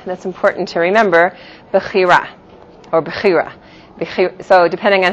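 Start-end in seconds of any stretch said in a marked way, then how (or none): 1.7-2.3 "bechira" with stress on the final open RA; Israeli pronunciation. Shown as a demonstration. Teacher renewing a vocabulary word for class, Israeli pronunciation. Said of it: "So the Israeli accent would be bechira". 3.0-3.5 "bechira" stressed on CHI, last vowel reduced to "uh"; yeshivish pronunciation. Shown as a demonstration. Teacher giving the other version of a vocabulary word, yeshivish pronunciation. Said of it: "The yeshivish accent would be bechira".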